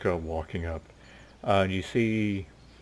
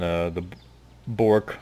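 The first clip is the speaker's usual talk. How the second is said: ordinary delivery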